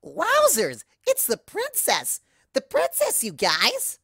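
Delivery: very high, loud, thrilled squeal; over-the-top giddy cartoon pony; squealing excitement. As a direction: shriek the news to all your friends. Pinky excitedly spots the princess.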